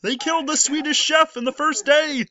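high, strained puppet frog lament; Kermit the Frog. Pretend. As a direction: cry it out as an outraged puppet frog. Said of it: "in the Kermit the Frog voice".

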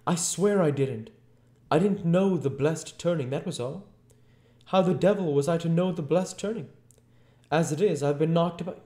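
high, loud tearful pleading; high-pitched, aggrieved wail of excuses; near tears. A narrator's reading. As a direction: protest loudly, close to crying. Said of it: "not far remote from tears".

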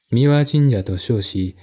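ordinary delivery, nothing marked

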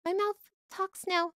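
normal talking voice

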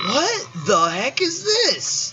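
high, slow bewildered shout; astonished shout. Real, not acted. Player shouting at a strange minigame. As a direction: drag it out in disbelief.

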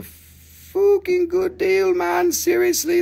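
very high, slow squeak; high squeaky voice. Pretend. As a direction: squeal out the great deal. Hypes the deal in a squeaky voice.

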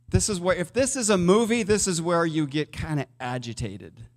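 normal talking voice

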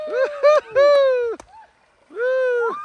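high, slow whooping cheer; excited whoop. Real, not acted. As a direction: whoop her on as she swims. Cheering the jump.

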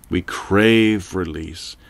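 plain reading voice

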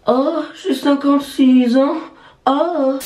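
very high, slow, loud squeal; put-on high voice. Vlogger jokingly answering how old they are, put-on high voice. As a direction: squeak it out in an exaggerated falsetto. Answers own age question in character.

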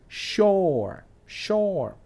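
SHORE pitched high, drawn out and falling; pronunciation model. Shown as a demonstration. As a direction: repeat "Shore" high, stretching the vowel. Repeats the dictionary's model word. ("Shore" agudo y alargado, como modelo)

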